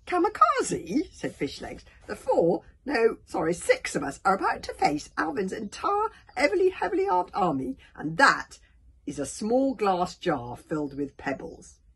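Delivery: loud, high, incredulous objection; exasperated protest. A narrator's reading. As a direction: spell it out in loud disbelief.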